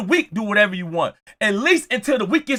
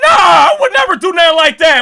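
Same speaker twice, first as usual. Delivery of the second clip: higher, louder panicked denial; loud mock denial; 6 semitones higher, 6 dB louder than usual. Hater denying to the player, loud panicky denial. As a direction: deny it too hard.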